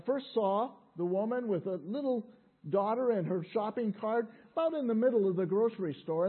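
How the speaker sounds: ordinary talk, nothing marked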